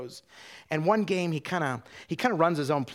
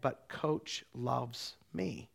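very slow, hushed and halting; quiet thought, spaced out with wonder; dawning inner realization; 6 dB quieter, much slower than usual. Retold or imitated. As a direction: let it land softly, word by word. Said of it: "he hears in his head".

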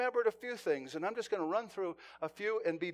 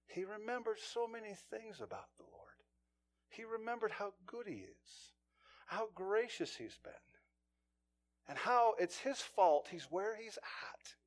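hushed; 9 dB quieter than usual. Acted. Preacher drops into intimate hush.

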